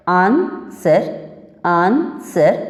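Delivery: Answer stretched very slowly, syllable by syllable; stressed demo word. Shown as a demonstration. Teacher slowly sounding out answer for the students. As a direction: draw out answer so every syllable is heard. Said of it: "स पे जोर पड़ रहा है".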